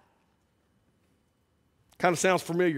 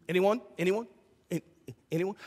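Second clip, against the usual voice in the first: rising questions fading to nothing; mock awkward asking; 6 dB quieter, faster than usual. Preacher mocking an unanswered call for volunteers, awkwardly. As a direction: ask into the silence.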